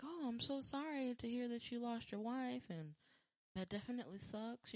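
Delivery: fake sympathy. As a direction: sound sorry without meaning it. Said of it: "I'm like".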